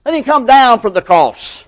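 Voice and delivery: high, loud scoffing taunt; jeering challenge. Retold or imitated. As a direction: call out a scornful dare.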